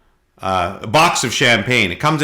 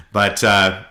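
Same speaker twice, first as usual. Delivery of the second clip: usual speaking voice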